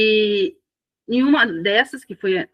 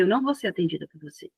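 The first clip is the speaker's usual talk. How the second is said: soft, even, not raised; calm firm refusal; 2 semitones lower, 7 dB quieter, faster than usual. Retold or imitated. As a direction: refuse quietly but firmly, no shouting. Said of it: "não gritei, não falei alto". (recusa calma e firme, sem levantar a voz)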